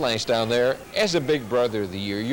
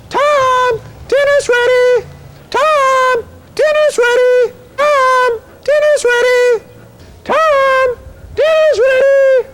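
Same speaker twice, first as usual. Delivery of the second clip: high, very loud call across the neighborhood; a mother hollering for her kid from the porch; calling kids to dinner; 12 dB louder, much slower than usual. Retold or imitated. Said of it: "she'd always pull one of these".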